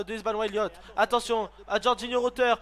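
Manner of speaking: ordinary talk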